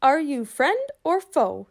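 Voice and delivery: slow, high, loud challenge; knight shouting. Acted. Knight shouts a challenge up at the boy, slow and loud. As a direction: challenge him slowly like a tiny knight. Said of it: "the knight shouted".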